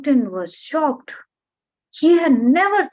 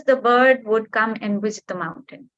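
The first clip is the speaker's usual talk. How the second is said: ordinary delivery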